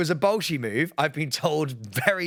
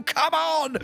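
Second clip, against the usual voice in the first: excited shout; 14 semitones higher than usual. Real, not acted. Hype shout mid letter.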